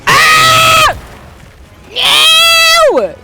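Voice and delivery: high frightened yells; scared shout. Real, not acted. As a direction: yell in panic. Screaming at monster.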